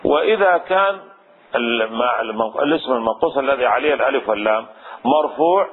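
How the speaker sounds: normal talking voice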